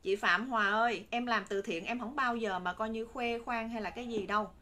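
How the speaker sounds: normal talking voice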